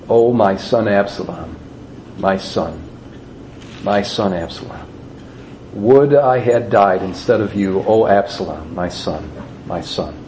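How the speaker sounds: low, hushed mourning; grieving lament. A narrator's reading. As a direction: read it as a father's quiet grief, voice sinking. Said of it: "went up to the chamber over the gate and wept".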